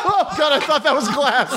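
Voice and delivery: startled gasp into laughter; jump, then burst out laughing. Real, not acted. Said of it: "you literally made me gasp".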